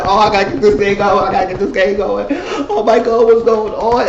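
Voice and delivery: high-pitched mock panic; frantic. Imitating Molly at the computer trying to get it going, frantic. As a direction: babble it high, imitating someone freaking out.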